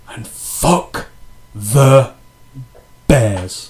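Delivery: loud, with a pause before "the" and before "Bears"; emphatic sign-off. Real, not acted. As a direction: punch "fuck", "the", "Bears" one at a time.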